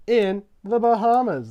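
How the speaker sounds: high, loud, stretched-out shout; triumphant drawn-out holler; hyped announcement. Acted. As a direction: belt it out slowly like a big reveal. Hyped announcement of island win.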